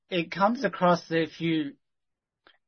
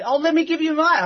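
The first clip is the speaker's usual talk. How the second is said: high, louder imitation of a quick offer; retold eager offer; 11 semitones higher, 5 dB louder than usual. Woman quickly offers her booklet, imitated eager high voice. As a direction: jump in high and keen, as if quoting her.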